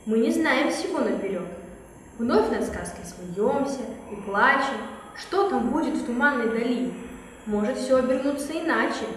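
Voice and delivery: measured rhyming storyteller voice; storyteller intro. Acted. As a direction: recite the verses like a fairy-tale host. Opening verse intro.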